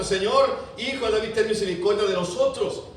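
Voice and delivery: loud, high desperate cry. Acted. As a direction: shout it over the crowd trying to hush you. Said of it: "Ellos gritaban más fuerte".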